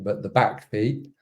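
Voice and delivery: ordinary delivery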